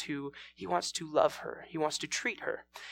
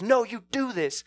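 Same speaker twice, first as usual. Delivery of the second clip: mild mock scream, higher-pitched; a touch of raised-voice bullying; acted angry yell; 6 semitones higher, 3 dB louder than usual. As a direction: snap out an order, short of screaming. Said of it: "he starts screaming at her".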